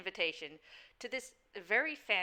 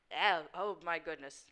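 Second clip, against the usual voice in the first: ordinary delivery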